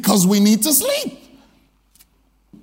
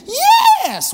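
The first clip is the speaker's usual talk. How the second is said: very high, louder squeal of a yes; excited child yes; 5 dB louder than usual. Retold or imitated. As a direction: burst it out like a delighted kid.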